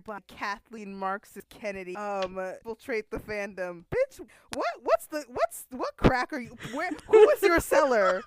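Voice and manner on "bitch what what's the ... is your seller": high, loud disbelief; loud, incredulous mock outrage; incredulous yelling. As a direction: shout in mock outrage at a ridiculous tweet. Mocking the tweeter's nonsense.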